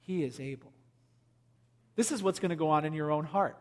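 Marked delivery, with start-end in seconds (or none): 0.0-0.7 much softer, lower, calm and sure; soft steady faith. Shown as a demonstration. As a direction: say it quietly with trust. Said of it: "you will hear the voice of faith saying".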